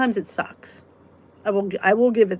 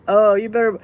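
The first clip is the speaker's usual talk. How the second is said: ordinary talk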